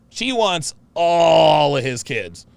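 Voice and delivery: "all" stretched long and loud; stress on "all". Real, not acted. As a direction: draw "all" right out for emphasis. Emphatic claim, word drawn out.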